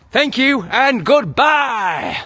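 slow, drawn-out shout of goodbye. Real, not acted. Signing off his video to viewers, slow angry shout. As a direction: yell the sign-off, stretching every word.

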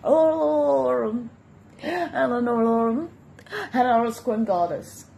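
mocking fake American accent, drawn-out hello. Mocking a rival's fake American accent on video. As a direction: ape her put-on American accent. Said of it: "fake American accent".

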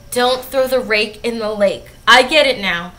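normal talking voice

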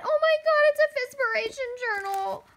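high, loud excited squeal; thrilled shriek opening a present. Real, not acted. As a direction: squeal with delight at the gift.

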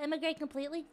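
normal talking voice

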